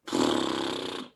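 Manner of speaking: sharp gasp before going under; demo gasp. Shown as a demonstration. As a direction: gasp in like a child about to dip. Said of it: "You go".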